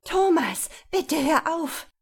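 high, loud, despairing cry; desperate. Acted. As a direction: plead for it to end. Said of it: "In Sabine machte sich Verzweiflung breit".

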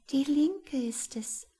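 high, slow, airy voice; a stagey whisper with the voice lifted high; hushed sunbeam. A narrator's reading. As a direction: confide it in a tiny high voice. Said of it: "flüsterte der eine".

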